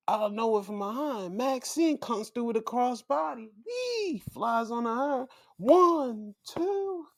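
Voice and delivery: high, loud hyped ring-announcer call; excited play-by-play. Acted. Vlogger calls the title win to viewers like a hyped announcer. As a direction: call the pin and crown her like a hyped commentator.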